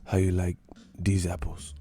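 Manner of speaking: mock boxer, subdued and accented; Miguel Cotto impression. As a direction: murmur the taunt with pauses. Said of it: "He's like".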